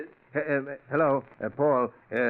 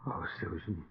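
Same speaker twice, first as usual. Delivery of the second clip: low, much softer, grief-stricken murmur; broken father's quiet lament; grieving; 7 semitones lower, 7 dB quieter than usual. Acted. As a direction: mourn her under your breath. Father grieving his dead daughter.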